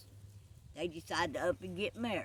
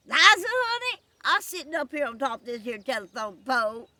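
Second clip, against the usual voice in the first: high, loud holler from up a pole; calling back; 7 semitones higher, 12 dB louder than usual. Acted. Rastus answering Liza from atop the telephone pole, calling back. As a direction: holler the name high, then ease slightly.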